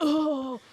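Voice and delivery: put-on loud, high old-lady "oh" of pain; hammed-up aching groan. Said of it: "like a old lady".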